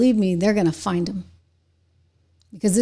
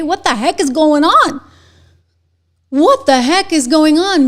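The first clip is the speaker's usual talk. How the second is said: much higher and louder, furious disbelief; loud, pitched-up indignant rant; outraged shout; 7 semitones higher, 8 dB louder than usual. Real, not acted. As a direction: shout it out in real outrage at the numbers. Outraged at poll results.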